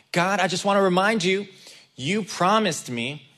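loud, pleading call upward; crying out to God. Retold or imitated. Jacob crying out to God in fear, loud plea. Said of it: "he starts crying out to God".

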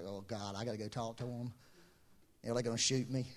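low, quiet, worried mumble; mock dread. Servant dreading going to the angry brother, quiet. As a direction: dread the task under your breath.

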